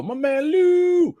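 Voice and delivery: high, drawn-out holler on the name; hyped shout-out. Real, not acted. Streamer greeting a viewer, hyped shout-out. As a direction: stretch the name out in a high whoop.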